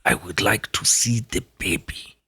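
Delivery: put-on hushed, low German-accented voice; soft German accent, almost a whisper; Herzog impression. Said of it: "You gotta keep it quiet too".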